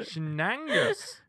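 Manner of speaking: slow, rising Shenangus; drawn out slowly. Real, not acted. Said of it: "savouring the odd word".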